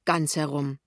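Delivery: usual speaking voice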